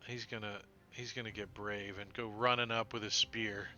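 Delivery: ordinary talk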